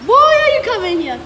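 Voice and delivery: loud, high call to a dog. Real, not acted. Calling the dog.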